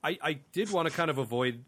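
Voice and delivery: normal talking voice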